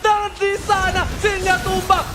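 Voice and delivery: rising, shouted esports call; excited play-by-play. Acted. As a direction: call the fight like a hyped caster. Hyped live esports call.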